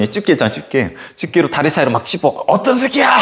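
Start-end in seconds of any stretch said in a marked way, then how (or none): 2.5-3.2 high taunting shout; rowdy yell. Retold or imitated. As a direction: jeer at whoever is inside. Students poke with tongs, yelling.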